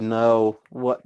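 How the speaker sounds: ordinary delivery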